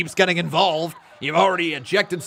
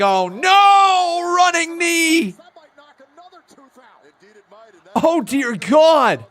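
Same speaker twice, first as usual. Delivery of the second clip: much higher, loud gasped alarm; shocked outcry; 12 semitones higher, 10 dB louder, much slower than usual. Real, not acted. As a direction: react to a brutal knee. Shocked reaction to a hit.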